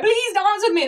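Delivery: put-on high, pleading plea to the boys; begging. In her dream, begging boys to dance with her, high and pleading. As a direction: beg like your night depends on it. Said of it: "like, begging these boys".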